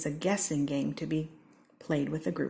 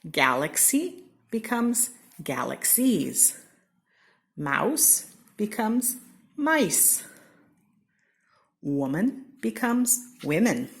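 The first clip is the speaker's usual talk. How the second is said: slow; 4 semitones higher, slower than usual. Shown as a demonstration. Example read slowly.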